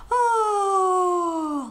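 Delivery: "Oh" groaned loud through a yawn. Shown as a demonstration. Teacher demonstrating O, big yawning groan. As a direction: yawn the vowel out. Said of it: "someone yawning".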